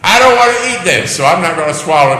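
put-on high, loud stubborn toddler; mock pouty child refusing food; child's stubborn voice. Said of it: "think about this".

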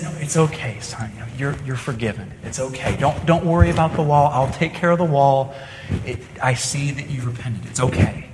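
put-on soft, gentle reassurance to a crying child; tender forgiving parent.

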